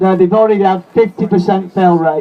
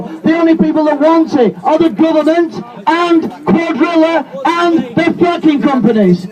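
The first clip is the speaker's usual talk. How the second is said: higher and shouted, slowed on the key lines; fiery rally speech; 8 semitones higher than usual. Real, not acted. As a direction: rouse the crowd at full force.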